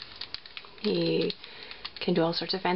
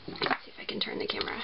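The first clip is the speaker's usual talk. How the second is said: whispered aside to herself; breathy whisper under her breath; 6 dB quieter, faster than usual. Real, not acted. Aside while turning camera.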